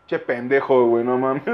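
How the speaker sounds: low drop out of the act; deadpan self-scolding. Real, not acted. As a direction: mutter it low to yourself. Drops act to scold himself.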